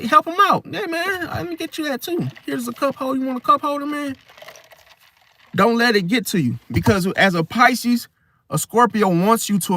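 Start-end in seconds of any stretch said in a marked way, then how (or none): 0.0-4.2 high, sugary over-helpful voice, trailing softer; mock eager helper. To a sabotaging Scorpio, high syrupy fake helpfulness. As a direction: kill them with fake kindness. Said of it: "be like".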